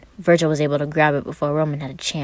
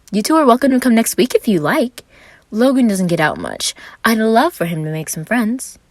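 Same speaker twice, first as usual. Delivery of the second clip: put-on higher, bright eager young man; Roman's voice; 6 semitones higher, 6 dB louder than usual.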